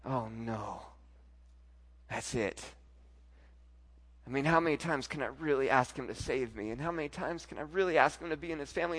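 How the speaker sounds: despairing inner voice. Retold or imitated. Believer doubting God will forgive again, hushed despair. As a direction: sink into quiet self-doubt. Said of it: "we think".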